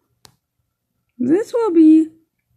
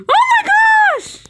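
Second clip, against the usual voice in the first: startled shout; 5 dB louder than usual. Real, not acted. Sudden scare in game.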